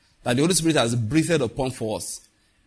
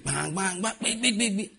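higher, quick vocal mimicry of pounding church music; imitated church music; 7 semitones higher, 3 dB quieter than usual. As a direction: mimic the banging band in mock noises. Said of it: "As they start singing".

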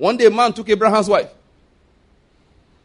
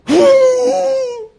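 loud squealing laugh; high shrieking laugh; 6 dB louder than usual. Real, not acted. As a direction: burst out in a shrill howl of laughter. Big laugh after joke.

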